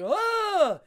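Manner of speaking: loud high startled crowd cry; sudden high yelp of alarm; crowd gasp. Retold or imitated. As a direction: shout it like a shocked crowd. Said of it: "the crowd's like".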